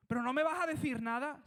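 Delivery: desperate yelled plea. Acted. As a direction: shout up at a silent God. Pleading silent God.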